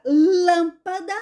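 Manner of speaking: "lâmpada" with LÂM drawn out and strong; stress on first syllable. Shown as a demonstration. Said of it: "a sílaba mais forte é a antepenúltima".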